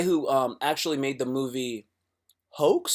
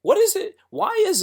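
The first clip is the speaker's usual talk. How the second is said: exasperated squeak; 5 dB louder than usual. Real, not acted. Exasperated outburst.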